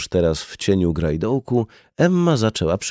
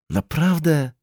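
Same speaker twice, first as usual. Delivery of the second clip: high-pitched questioning surprise; surprised; 8 semitones higher, slower than usual. A narrator's reading. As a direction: ask in mild disbelief, pitch lifted. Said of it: "zdziwił się".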